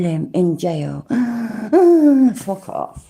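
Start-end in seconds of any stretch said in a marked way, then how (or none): 1.1-2.3 sharp, high gasp; a sudden loud gasp; loud mock gasp. Reacting to kill threat. 2.3-3.1 whispered curse. Acted. Reacting to kill threat.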